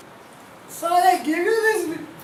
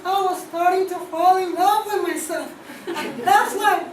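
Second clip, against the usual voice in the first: usual speaking voice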